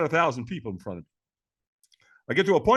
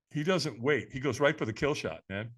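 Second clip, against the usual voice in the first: ordinary talk, nothing marked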